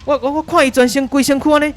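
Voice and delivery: a newsman losing his nerve on air; terrified reporter. Acted. Reporter Carlo seeing tentacles emerge live, terrified and loud. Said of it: "咱继续转来甲卡洛这边".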